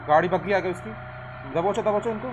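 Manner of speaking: loud, high urgent yell; shouting callout. Real, not acted. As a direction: shout to the squad to grab them. Enemy buggy arrives, calling out.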